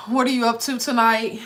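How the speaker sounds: normal talking voice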